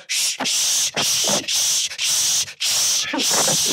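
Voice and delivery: unvoiced insistent shushing; a string of sharp shushes. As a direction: hush him urgently. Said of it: "So then I'm going".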